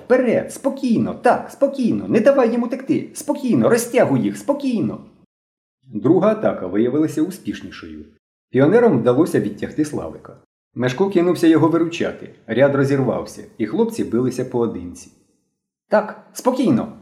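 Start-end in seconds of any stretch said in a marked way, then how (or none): 0.1-5.0 put-on high-pitched boy's battle cries; excited shouting. A narrator's reading. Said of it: "і кричав". 15.9-16.9 put-on high, slow boyish call; shouted order. A narrator's reading. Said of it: "кричав білобрисий".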